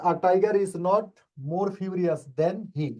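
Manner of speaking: slow, deliberate, word by word; slow model sentence. Shown as a demonstration. Teacher reading the answer sentence slowly to the class. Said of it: "teacher reads answer slowly".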